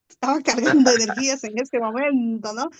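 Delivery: a real laugh breaking into the line; laughing while saying it. Real, not acted. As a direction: repeat it with a chuckle in the middle.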